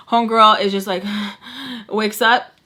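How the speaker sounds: ordinary delivery, nothing marked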